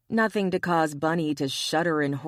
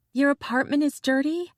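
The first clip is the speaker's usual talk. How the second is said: indignant; 10 semitones higher, 3 dB louder than usual. Acted. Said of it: "Glory glared up at him".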